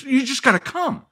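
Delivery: very high-pitched mock woman's voice; insistent refrain. Woman dodging the caller's questions, high mock insistence. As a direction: imitate her pushy refrain way up high.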